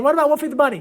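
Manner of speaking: hammed-up insistent student nagging; imitating a pushy kid. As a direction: badger the teacher like an eager student. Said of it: "he just kept saying".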